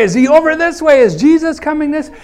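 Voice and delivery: high, urgent questions of a blind man; desperate asking. Retold or imitated. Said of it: "Maybe he's asking along the way".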